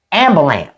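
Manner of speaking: AMBULANCE as "am-buh-lamp", final sound swallowed; Southern "am-buh-lamp"; deliberately wrong ambulance. Shown as a demonstration. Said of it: "I really have to work".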